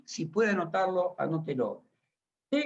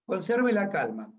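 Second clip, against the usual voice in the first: usual speaking voice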